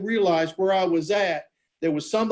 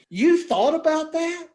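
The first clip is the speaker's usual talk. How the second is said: higher-pitched, amazed retelling; incredulous disbelief; 4 semitones higher than usual. Retold or imitated. Uncle Mike to the hit pitcher, incredulous. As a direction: question it in astonished disbelief.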